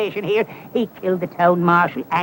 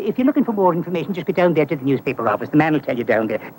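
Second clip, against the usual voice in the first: fast, nervous brush-off; hurried excuse; 3 semitones lower, faster than usual. Acted. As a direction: rattle it off to get away. Hurrying to get away.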